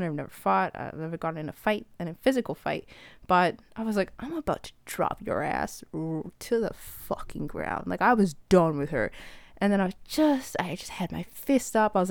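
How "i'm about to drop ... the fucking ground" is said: quiet threat. Retold or imitated. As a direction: threaten her quietly before a fight. Threatening opponent, fist raised.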